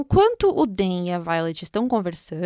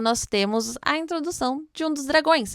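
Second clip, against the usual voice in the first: ordinary talk, nothing marked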